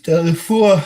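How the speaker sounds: said mid-yawn, high and loud; words stretched by a yawn. Real, not acted. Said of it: "Sorry, I'm yawning".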